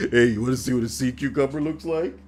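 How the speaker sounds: leering pervy stranger voice; pervy creep. Retold or imitated. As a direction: put on a gross creepy come-on. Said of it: "gross and pervy".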